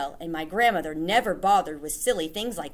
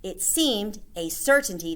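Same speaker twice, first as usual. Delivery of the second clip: ordinary delivery, nothing marked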